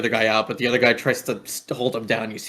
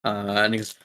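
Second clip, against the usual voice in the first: ordinary talk, nothing marked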